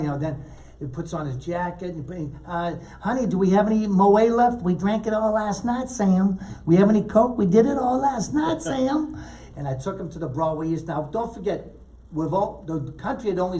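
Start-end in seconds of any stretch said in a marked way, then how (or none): 3.0-9.2 voicing the couple. Acting Kinison and girlfriend's exchange.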